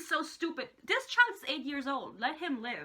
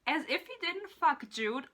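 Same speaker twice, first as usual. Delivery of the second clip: ordinary delivery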